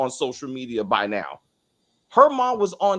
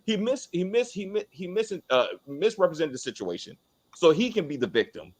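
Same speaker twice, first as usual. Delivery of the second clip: ordinary talk, nothing marked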